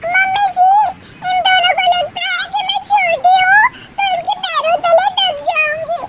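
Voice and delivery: very high, whiny and teary; a childish youngest bahu insisting on her rights; youngest daughter-in-law. Acted. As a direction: youngest daughter-in-law protesting tearfully to mother-in-law.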